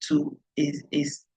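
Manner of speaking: ordinary talk, nothing marked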